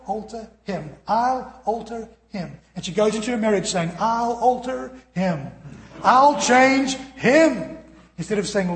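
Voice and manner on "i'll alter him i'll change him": very slow, higher and louder, "alter" stretched out; drawn-out punchline. Acted. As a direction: stretch "I'll alter him" for the joke.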